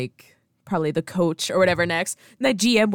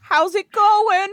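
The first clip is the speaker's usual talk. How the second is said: high, loud, drawn-out greeting; hammed-up high character voice; put-on caller voice; 12 semitones higher, 6 dB louder, much slower than usual. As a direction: play a folksy caller, stretching the words. Imitates team owner on phone.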